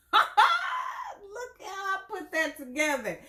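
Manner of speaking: high, laughing shout at her own pun. Real, not acted. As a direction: crack up and call it out.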